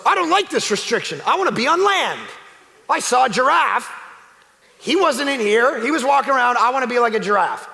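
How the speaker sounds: high, loud petulant complaint; a sulky fish begging for dry land; whiny fish. Acted. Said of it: "But if a fish goes".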